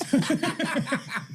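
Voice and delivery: a sudden high cackle at his own joke; high burst of laughter. Real, not acted. Laughing at his own punchline, high cackle.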